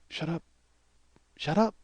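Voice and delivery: soft, urgent hush under the breath; hushed shut up. Retold or imitated. Husband hushes his wife in front of the neighbours, soft and urgent. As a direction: quietly warn her to stop. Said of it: "I'm like".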